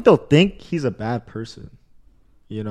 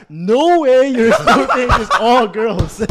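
higher, drawn-out mock disbelief; slow, high-pitched imitation of her shock; imitating disbelieving girlfriend; 7 semitones higher, slower than usual. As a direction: retell her incredulous reaction, half laughing. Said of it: "she was like".

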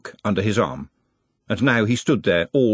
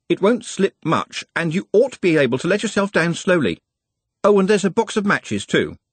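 put-on lightly raised eager boy's voice; a keen apprentice calling up helpfully; eager young lad; 8 semitones higher than usual. A narrator's reading. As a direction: candle boy calling up to his master. Nut explains rescue from below.

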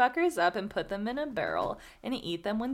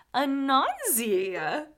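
slow, drawn-out laughing groan of the name; 4 semitones higher, 4 dB louder, much slower than usual. Real, not acted. Mock groan at Anansi's cruelty.

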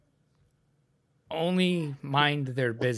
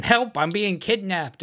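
higher, louder imitated cry for help; mock scream; 6 semitones higher, 6 dB louder than usual. Child being loaded into the car, mock screaming. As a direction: retell a child's scream, half-hearted. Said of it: "That's like Cassie screaming".

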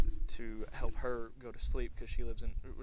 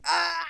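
high, loud yell; scream of pain; 12 dB louder than usual. Retold or imitated. Friend retelling a night-time leg cramp, screaming. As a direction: reenact a sudden cramp scream. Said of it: "And I screamed".

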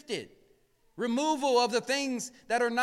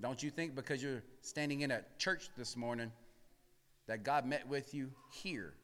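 much quieter, lower, intimate preaching; to the congregation, a hushed low aside; 12 semitones lower, 16 dB quieter than usual. Real, not acted. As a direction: lean in and say it softly.

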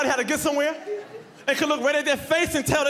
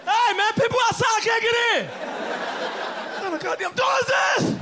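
exasperated yell; 8 semitones higher than usual. Retold or imitated. Locked out, yelling at door.

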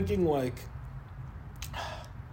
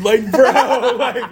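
laughing; 9 semitones higher, 12 dB louder, much slower than usual. Real, not acted. Cracking up at the image.

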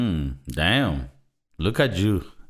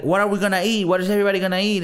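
higher, lightly voiced Mark scolding; imitating Mark; 8 semitones higher, much faster than usual. Retelling Mark's scolding scene, lightly voiced imitation. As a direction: mildly mimic the brother telling him off. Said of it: "he says, like".

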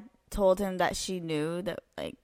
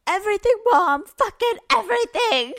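put-on high, loud, over-the-top teenage wail; dramatic retold outburst; 14 semitones higher, 11 dB louder than usual. Daughter confessing everything to her mom, dramatic outburst. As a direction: blurt it out dramatically at mom, mocking yourself. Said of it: "Me and my dramatic ass".

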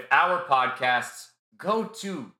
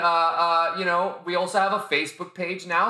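usual speaking voice

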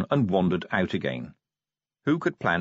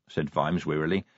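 usual speaking voice

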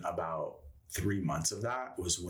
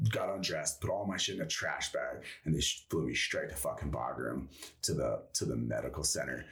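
ordinary talk

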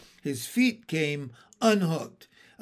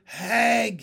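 high, louder drawn-out word; slow, puzzled echo; said slowly; 5 semitones higher, 5 dB louder than usual. A narrator's reading. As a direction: stretch out "hag" as if new to it. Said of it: "he said slowly".

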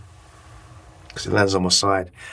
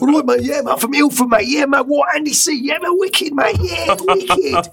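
excited fan. Retold or imitated. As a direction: play the starstruck fan from Ilford, all hype. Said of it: "you get the odd kind of like".

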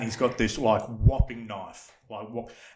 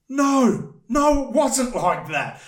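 high, loud, slow denial; emphatic denial; 11 semitones higher, 6 dB louder, slower than usual. Retold or imitated. To the woman officer, high loud denial. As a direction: insist flatly it wasn't. Denying to the police.

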